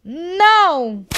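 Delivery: loud, high group no; all refuse together, loudly; defiant shouted no; united shout. A narrator's reading. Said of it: "everyone took a stand and said".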